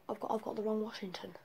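fast whispered aside to self; hurried self-correcting whisper. Real, not acted. Muttered aside after a mistake.

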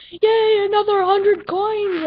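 high, loud delighted yay; excited cheer. Real, not acted. Gamer celebrating a hundred coins, excited.

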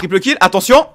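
hyped, loud multikill call; esports caster. Acted. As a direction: shout it like a caster.

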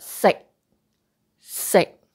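"sick" chopped short with a silent K; stopped K. Shown as a demonstration. Teacher repeats for learners, "sick" with unreleased K. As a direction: hold the K of "sick" in, no release. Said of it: "You don't pronounce that K out".

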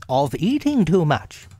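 high, emphatic telling-off; lifted, reproving storybook animal voice; stern. A narrator's reading. Rabbit scolding greedy Pooh, high stern voice. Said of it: "said Rabbit sternly".

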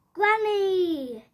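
high, loud delighted yell of Granny. Acted. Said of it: "yelled Bubble and Squeak".

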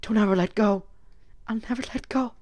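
softly, mock movie romance; a hushed tender movie line; mock romantic. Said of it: "I turned this into a romance".

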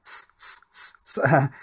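short whispered hissing "S, s" bursts; hissed s sounds. Shown as a demonstration. Testing the top filter channel, hissing "S, s" sibilance. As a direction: hiss into the mic to test the treble channels. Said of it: "responds to the sibilance".

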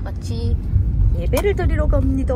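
muffled behind mask; speaking under mask; through a face mask. Real, not acted. Seen 0: Speaker wears pink mask over mouth and nose throughout.

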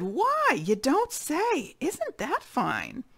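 very high, slow, marvelling tiger voice; delighted tiger. Acted. Tiger marvels at gum that grows, very high and slow.

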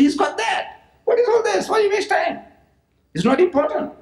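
high, sneering impression of a scoffer; a lightly mocked high sneer; mocking dismissive voice. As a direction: toss it off as a scoffer would.

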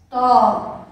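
"Toalha" loud and clear in three syllables; careful correct pronunciation; correct model word. Shown as a demonstration. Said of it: "três sílabas".

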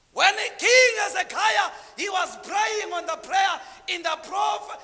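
loud, high fervent sermon; preacher in full flow; full-force preaching. Real, not acted. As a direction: drive the story home to the crowd.

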